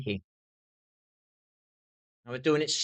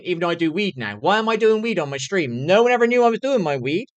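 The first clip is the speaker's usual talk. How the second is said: rising, louder angry rant; heated, louder rant at the companies; real anger; 5 semitones higher, 7 dB louder than usual. Real, not acted. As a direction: let the frustration boil over into a loud outburst. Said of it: "I'm a bit pissed off at the moment".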